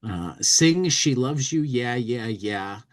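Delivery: usual speaking voice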